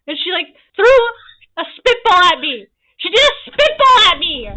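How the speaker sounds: loud, high indignant exclamation; playfully scandalized outburst; mock outrage. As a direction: exclaim in hammed-up disbelief, punching each phrase. Indignant climax of spitball story.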